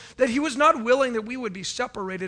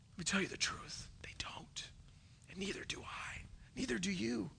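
hushed confiding whisper; 15 dB quieter than usual. Real, not acted. Preacher whispers a hard truth to the congregation.

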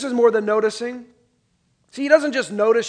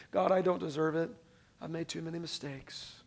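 much softer, defeated confession; quiet despair; 4 semitones lower, 12 dB quieter than usual. Retold or imitated. A sinner disqualifying himself before God, soft and defeated. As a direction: murmur it like giving up on yourself. Self-condemning prayer to God.

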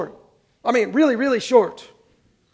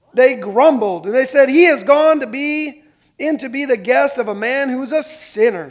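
scripture reading; 4 semitones higher, 7 dB louder than usual. Acted.

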